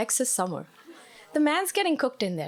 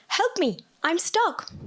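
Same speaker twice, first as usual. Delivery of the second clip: trapped man pleading; 7 semitones higher, slower than usual. Retold or imitated. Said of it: "The voice responded".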